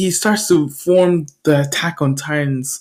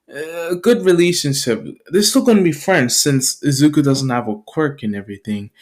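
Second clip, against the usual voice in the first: usual speaking voice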